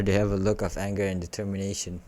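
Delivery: ordinary delivery, nothing marked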